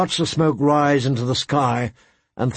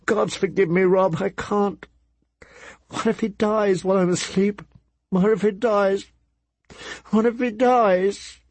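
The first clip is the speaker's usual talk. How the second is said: spiral into fearful sobbing; mother weeping, afraid her son dies; tearful panic; 7 semitones higher than usual. Acted. Mother weeping after "she wept.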